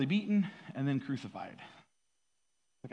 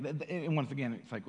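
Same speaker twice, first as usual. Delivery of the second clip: ordinary delivery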